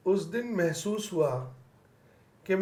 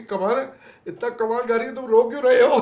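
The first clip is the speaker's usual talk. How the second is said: laughing quote; 6 semitones higher, 7 dB louder, faster than usual. Retold or imitated. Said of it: "वो बोलेगी".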